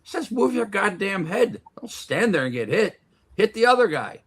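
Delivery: put-on high insistent old trainer; quoting old trainer. Old trainer drilling the boy on defense, high insistent voice. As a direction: drill it in like a gym coach. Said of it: "he goes".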